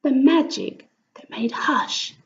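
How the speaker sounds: plain reading voice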